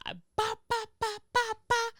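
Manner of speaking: high-pitched ba ba ba gibberish; mock angry babble. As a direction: imitate her ranting as nonsense syllables. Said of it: "She started".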